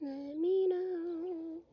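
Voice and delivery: slow drawn out. Shown as a demonstration. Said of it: "I'm being so straight up".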